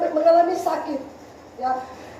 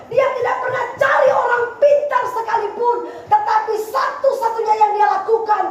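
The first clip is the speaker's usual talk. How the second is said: high, insistent preacher's cadence; preaching at full force; 6 semitones higher than usual. Real, not acted. Preacher insisting Hizkia never sought shortcuts, full-force preaching. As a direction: pound out each repeated denial.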